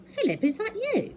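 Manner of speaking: very high worried call; anxious question. Acted. Calling friend on phone.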